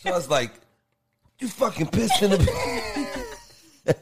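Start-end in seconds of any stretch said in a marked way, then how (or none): 1.4-4.0 high, slow, laughing yell; laughing mock accusation. Boyfriend retelling how he blamed her, laughing. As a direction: drag it out through laughter.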